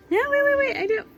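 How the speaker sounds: teasing a child with fake alarm; mock protest. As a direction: pretend to catch yourself.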